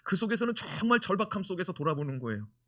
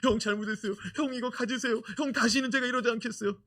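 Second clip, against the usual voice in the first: pleading Joseph; 8 semitones higher, 3 dB louder than usual. Retold or imitated. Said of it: "막 그러면서 막 옷을 막 벗기지".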